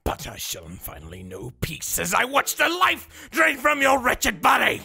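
from whisper to loud yell; whisper building to a shout. Pretend. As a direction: start hushed, end roaring.

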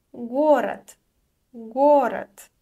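teacher pronunciation demo; careful Russian word. Shown as a demonstration. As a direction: stress го in город. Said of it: "pronounced as unvoiced t".

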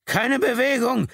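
very high, loud, slow police shout; roared command. Acted. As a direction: bellow it to freeze the driver. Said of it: "brüllte er".

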